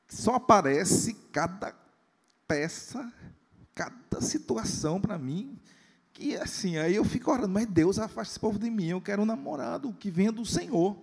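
voicing a young woman. Pastor imitating a young churchgoer complaining about suitors, mildly whiny. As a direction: whine it like a frustrated girl.

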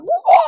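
a sudden, very high, very loud kung-fu "Wah!"; Jackie Chan battle cry. Pretend. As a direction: shriek it like a movie kung-fu fighter. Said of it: "I'll be like freaking Jackie Chan".

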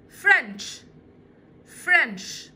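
فرنش said loud and clear, falling; teacher drilling French for children; model word. Shown as a demonstration. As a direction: say فرنش firmly with a ch ending. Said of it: "تعالوا نشوفهم كده".

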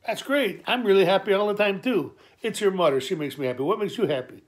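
dad gushing happily at his son; overjoyed dad. Retold or imitated. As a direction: beam it out, all cheer.

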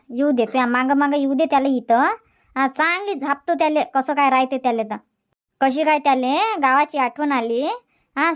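usual speaking voice

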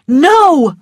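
high, loud cry of refusal; alarmed cry. A narrator's reading. Missus Meade forbids her son to go, alarmed cry. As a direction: cry out to stop your son. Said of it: "cried Missus Meade".

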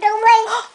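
loud delighted gasp; mock gasp. Mother gasping at the picture for her toddler, playful. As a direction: gasp to make the toddler look.

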